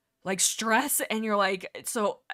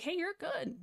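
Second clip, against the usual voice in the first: soft, higher, gentle reassurance; calm reassurance; 8 semitones higher, 7 dB quieter than usual. Retold or imitated. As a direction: imitate a paramedic soothing a patient. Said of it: "be so calm and just be so like".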